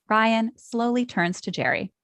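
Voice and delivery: ordinary talk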